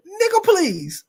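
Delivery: high-pitched mimicry of the dude; put-on high voice, mock dismissive.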